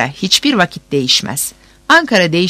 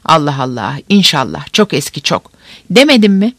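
ordinary talk, nothing marked

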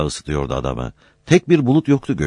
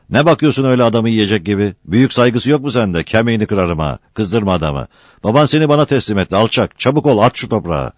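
higher, louder bullying threats; angry scolding; 6 semitones higher, 4 dB louder than usual. A narrator's reading. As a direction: scold him like a menacing uncle. Uncle threatens and orders nephew. (öfkeli, tehditkâr azarlama)

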